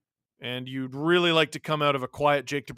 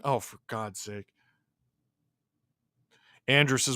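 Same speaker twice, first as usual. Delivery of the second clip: ordinary talk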